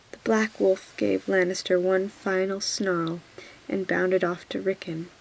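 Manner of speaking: ordinary delivery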